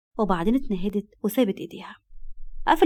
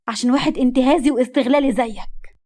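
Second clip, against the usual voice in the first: loud, indignant condemnation; worked-up accusation; 3 semitones higher, 5 dB louder than usual. A narrator's reading. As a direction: hurl the insult at him angrily. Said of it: "وقالت بانفعال بعد ما خرجت عن شعورها".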